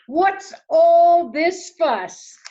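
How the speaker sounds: high, loud, slow put-on voice; big, drawn-out mother owl voice. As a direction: ask grandly as the mother owl.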